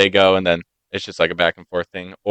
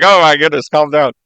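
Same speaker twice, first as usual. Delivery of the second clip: louder, higher exclamation; taken aback; 7 semitones higher, 6 dB louder than usual. Acted. Onlooker reacting to train passengers screaming, taken aback. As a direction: act out mock dismay.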